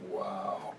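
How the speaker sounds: ordinary talk, nothing marked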